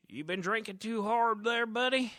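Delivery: high, loud mocking question from a street guy; loud high scruffy stranger voice; scraggly stranger. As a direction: tease the drunk-looking man.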